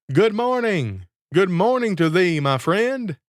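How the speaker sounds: high, carrying call from the tree. Acted. Man in the sack hails the student below, calling out high. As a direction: call out cheerily to a passer-by. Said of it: "he cried out".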